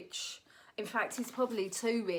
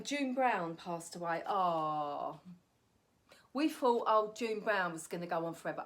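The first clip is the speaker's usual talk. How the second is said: ordinary talk, nothing marked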